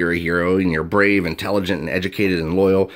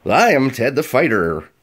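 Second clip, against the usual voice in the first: higher-pitched, loony hero announcement; wacky, pitched-up character voice; crazy person; 6 semitones higher, 3 dB louder than usual. Shown as a demonstration. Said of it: "talk like a crazy person".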